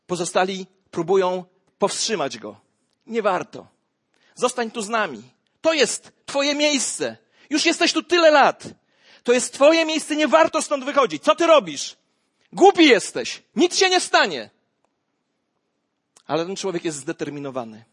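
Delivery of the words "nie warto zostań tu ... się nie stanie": higher, louder urging; pleading. Acted. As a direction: hold him back, insisting. Lepers urge him to stay. (inni trędowaci go zatrzymują, głośno i natarczywie)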